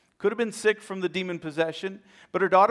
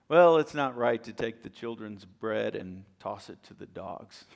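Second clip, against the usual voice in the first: much lower, deliberate testing voice; stern Jesus; 8 semitones lower than usual. Retold or imitated. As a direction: drop the voice deep to test her.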